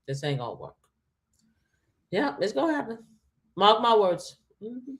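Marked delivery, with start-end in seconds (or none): 0.1-0.8 much lower and softer Trump voice, grudging; Donald Trump. Retold or imitated. Trump under pressure conceding the plan fails, low grudging impression. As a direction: mutter it like Trump backing down. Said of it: "and he gonna be like".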